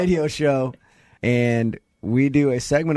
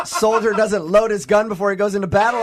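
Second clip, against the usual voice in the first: laughing hard; 9 semitones higher than usual. Real, not acted. Host backing Doug, laughing hard. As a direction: crack up while defending Doug.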